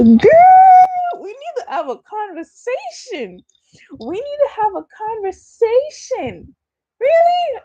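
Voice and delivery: playful high-pitched thrill; squealing excitement. Real, not acted. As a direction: gush in a very high voice. Gushing reaction on partner's home country.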